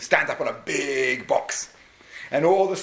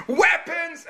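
commander's shout; 13 semitones higher than usual. Retold or imitated. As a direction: bark the next item out. King calling.

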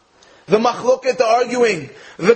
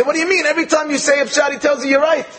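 pitched way up in puzzled disbelief; baffled question; 6 semitones higher, faster than usual. Retold or imitated. High, incredulous retelling of the sages. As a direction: sages asking Rabbi Yochanan why he complains, incredulous. Rabbis puzzled by Rabbi Yochanan's complaint.

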